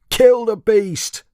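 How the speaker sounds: high, slow, loud shout to kill; angry shout. A narrator's reading. Farmer shouting at the dragon, loud and high. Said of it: "cried one farmer".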